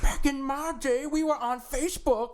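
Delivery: hammed-up high elderly voice; mock old-timer. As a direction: echo a cartoon oldster's reminiscing. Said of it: "Showing Your Age Via References Hour".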